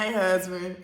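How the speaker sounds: mock call to husband. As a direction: sing out a teasing hello to him.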